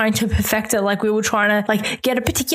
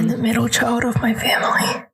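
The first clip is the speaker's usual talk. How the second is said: whisper; 2 semitones higher than usual. Real, not acted. Joking confession aside.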